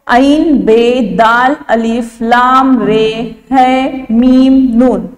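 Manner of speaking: slow, spelled-out letter names; name each letter slowly for the class; slow letter spelling. Shown as a demonstration. Teacher spells Abdul Rahman letter by letter, slow and deliberate. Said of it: "लाम को रे में मिलाकर पढ़ते हैं".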